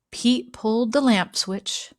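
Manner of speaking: usual speaking voice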